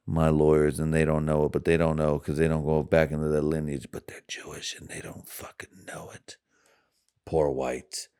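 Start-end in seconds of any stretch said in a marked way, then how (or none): 3.9-6.4 conspiratorial whisper, like sharing a secret; whispered aside. Acted. Secret aside about his lawyers.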